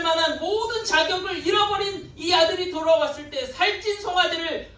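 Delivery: high, insistent sermon cry, easing at the very end; full-force preaching. Real, not acted. Preacher arguing the father would give his son anything, full-force fervour. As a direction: drive each phrase home, pitched high.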